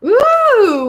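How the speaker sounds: loud, high drawn-out ooh; long impressed "ooooh"; excited ooh. Real, not acted. Reader turns up the shadow card, excited high ooh.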